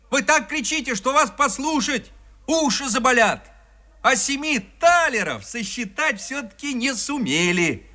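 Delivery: high-pitched indignant tirade; scolding shout. A narrator's reading. Peasant curses big-mouthed frogs at evening, scolding. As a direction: berate them from across the water. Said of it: "Он выбранил лягушек и крикнул".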